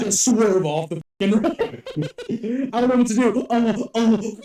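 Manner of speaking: high, loud panic broken by laughter; mock panic. Friend teasing the nervous driver, in loud mock panic. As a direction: act out a hammed-up, laughing freak-out. Joking acted panic while driving.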